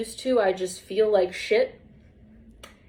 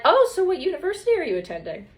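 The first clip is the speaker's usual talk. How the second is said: eager doctor; 8 semitones higher, faster than usual. Retold or imitated. Imitating doctors' eager question.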